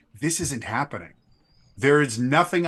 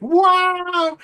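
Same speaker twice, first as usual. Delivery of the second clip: very high, loud held wow of glee; thrilled squeal of wonder; delighted wow; 8 dB louder than usual. Acted. Fubari swung around mid-fall, loud high delighted wow. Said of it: "she's like".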